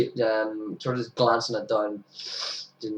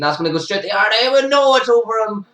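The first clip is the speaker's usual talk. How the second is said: high, loud and fast play call; rising alarm as the ball flies; real excitement, higher and louder; excited call; 11 semitones higher, 7 dB louder than usual. Real, not acted. Live call, near catch.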